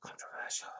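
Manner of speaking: voiceless stage whisper; whispered aside. Pretend. Host commenting on the criticism of Shudu, whispered aside.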